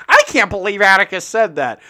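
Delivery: loud, high shocked parent voice. Retold or imitated. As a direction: play the scandalised parent. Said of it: "Beth and Steve are just sitting there".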